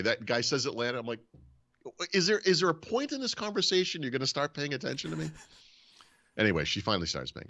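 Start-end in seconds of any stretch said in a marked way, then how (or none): 2.1-5.3 high, exasperated mock complaint. Husband to a wife not paying attention, retold exasperation. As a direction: re-enact pleading to be listened to at last.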